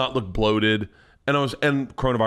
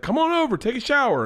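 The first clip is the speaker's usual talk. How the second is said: much higher, mock holler without much volume; imitated calling over; 11 semitones higher than usual. Comedian replays [name]'s invitation, high imitated call. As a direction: call a friend over, pitched up.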